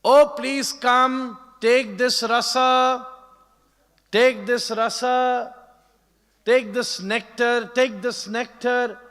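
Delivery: high, loud, slow call to the crowd. Retold or imitated. Nanda Baba calling everyone to take nectar, loud and drawn-out. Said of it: "started calling each and every one".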